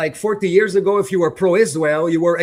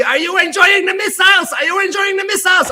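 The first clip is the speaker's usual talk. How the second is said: mock yelling; 11 semitones higher than usual. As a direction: shout the taunt like a heckler. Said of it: "who was yelling at me".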